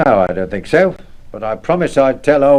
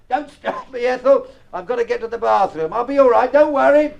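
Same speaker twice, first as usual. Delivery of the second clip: flustered; 8 semitones higher than usual. Acted. Rushing to bathroom, flustered.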